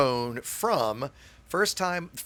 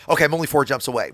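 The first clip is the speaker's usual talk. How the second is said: quick, louder mock eager kid voice; eager kid announcing; 8 dB louder, faster than usual. Imitating Pelly eagerly announcing each jump, louder. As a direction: rattle it off like an over-keen kid reporting in.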